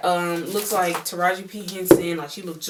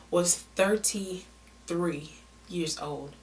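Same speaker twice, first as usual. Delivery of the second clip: slow reveal; 6 dB quieter, much slower than usual. Shown as a demonstration. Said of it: "slow revealing age".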